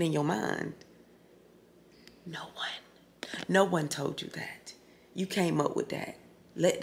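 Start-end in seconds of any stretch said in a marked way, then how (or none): 2.3-4.7 whispered, much softer. Real, not acted. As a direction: breathe it out gently. Soft advice to viewers.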